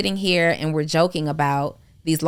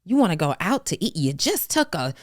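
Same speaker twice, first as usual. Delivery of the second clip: incredulous mom; 6 semitones higher than usual. Retold or imitated. Imitating her mom, shocked she wants lunch after the loss, incredulous. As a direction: play the mom who can't believe her ears. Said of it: "my mom is like".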